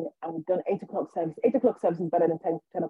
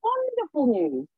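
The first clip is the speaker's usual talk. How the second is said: overjoyed vicar; 8 semitones higher than usual. Retold or imitated. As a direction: gush like a vicar hearing wonderful news. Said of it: "so grateful and so happy for me".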